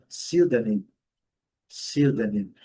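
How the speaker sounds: "pseudônimo" as SIU-da-nim, first syllable hit hard; primeira sílaba forte. Shown as a demonstration. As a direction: punch the SIU of English "pseudônimo", falling after it. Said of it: "primeira sílaba forte". ("pseudônimo" com "SIU" bem forte, sem P)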